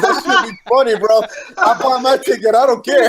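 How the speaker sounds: high, loud, amused outburst; laughing excitedly. Real, not acted. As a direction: crack up while insisting. Cracking up at his own idea.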